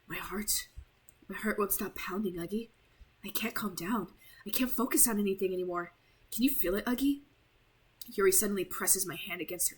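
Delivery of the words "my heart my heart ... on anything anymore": whispered, softer, unsettled; obsessive whisper. Acted. Yuri confessing to Uggy, hushed whisper.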